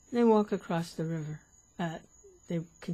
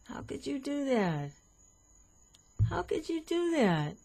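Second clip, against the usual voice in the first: high, rising outraged scolding; a scandalised monk who can't believe it, pitched way up; indignant monk; 10 semitones higher, 4 dB louder than usual. Acted.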